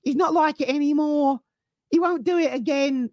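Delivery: high, pleading mock whine; Little Mo. Fan defending a player like a soap victim, high wheedling. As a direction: plead for him in a squeaky little voice. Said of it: "It sounds like Little Mo from EastEnders".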